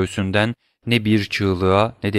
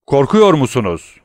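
scornful, louder taunt; contemptuous; 5 semitones higher, 7 dB louder than usual. Acted. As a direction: sneer the question with contempt. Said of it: "aşağılayıcı bir ses tonuyla".

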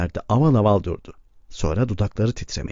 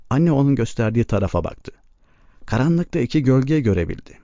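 usual speaking voice

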